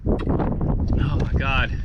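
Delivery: slow, drawn-out relieved sigh. Real, not acted. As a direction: savor the relief, very slowly. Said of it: "truly incredible feeling".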